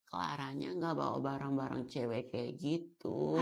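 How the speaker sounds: soft, mumbly pick-me voice; soft spoken. Acted. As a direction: murmur like you're too delicate to speak up. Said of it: "pelan banget sih lo ngomongnya".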